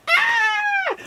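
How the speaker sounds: very high, thin scream; mock bridal shriek of joy; excited squeal. Bride's squeal at her news.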